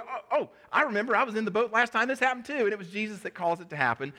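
high-pitched, acted rush of recognition; imitating an excited disciple; excited realization. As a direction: act out a memory suddenly clicking. Acting Peter suddenly recognizing Jesus.

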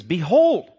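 BEHOLD high, loud and emphatic. Acted. Preacher shows the Bible getting emphatic, BEHOLD called out loudly. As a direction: hit BEHOLD hard, like an alarm. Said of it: "the Bible gets emphatic".

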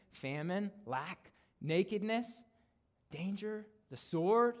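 loud, ringing scripture proclamation; preaching at full force. Acted. Preacher proclaiming Romans to the congregation, loud and ringing. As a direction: build the list like a rising sermon.

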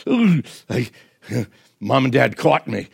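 put-on a kid who thinks getting caught is funny; giggly caught kid. Preacher voicing a caught kid, giggling it off.

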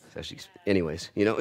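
usual speaking voice

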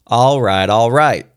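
warning. A narrator's reading. Father ending the argument with the children, warning. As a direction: raise your voice in mild warning. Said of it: "I warned".

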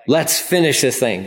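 normal talking voice

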